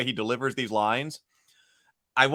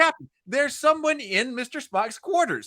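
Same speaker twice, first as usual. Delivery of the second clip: higher, hammed-up alarmed officer; hammy Chekhov impression; 10 semitones higher than usual. Podcaster mimicking Chekhov reporting trouble, high mock-alarmed. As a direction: squeak out the alarm with mock offence. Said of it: "he always delivers lines like that".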